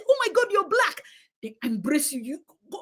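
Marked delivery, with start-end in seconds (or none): 0.1-1.0 high-pitched mock excited gasp of recognition; put-on delighted surprise, much higher; mock gushing. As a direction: imitate an over-thrilled fan, pitch shooting up. Mimics people gushing over someone.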